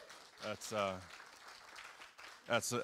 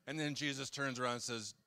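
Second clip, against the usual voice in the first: ordinary talk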